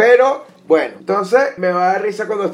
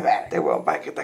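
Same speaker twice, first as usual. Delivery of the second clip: much lower, half-whispered mock voice; deep scornful muttered aside; mocking a guy; 14 semitones lower than usual. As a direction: sneer at him in a deep, breathy put-on voice. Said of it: "tú dices".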